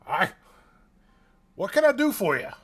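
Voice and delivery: high, loud jolly dwarf; cheery dwarf. Acted. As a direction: greet them with booming cheer. Dwarf spins around.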